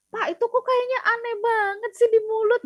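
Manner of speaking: high-pitched imitation of a doubting trainee; mock complaint. Trainee objecting to the trainer about an awkward script, high-pitched mock complaint.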